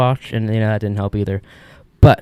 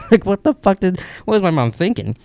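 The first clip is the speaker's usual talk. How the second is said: incredulous; 8 semitones higher than usual. Real, not acted.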